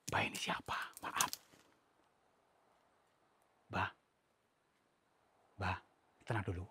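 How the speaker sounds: unvoiced hushed question; whisper. Real, not acted. Ghost hunter whispering to the old spirit, real whisper. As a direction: ask it softly, afraid to provoke.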